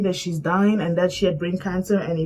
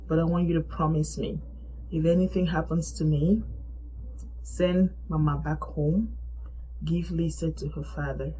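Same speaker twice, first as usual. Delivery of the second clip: soft, low retelling of weakened words; out of breath; 4 semitones lower, 7 dB quieter than usual. Retold or imitated. Sick friend gives her last requests, weakly, out of breath. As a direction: lightly voice a weak patient, hushed and spaced out. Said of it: "she was out of breath".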